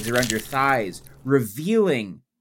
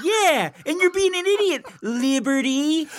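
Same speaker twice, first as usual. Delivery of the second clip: high squeaky voice. Acted. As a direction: squeak it like a tiny inner voice. Memory voice, high pitched.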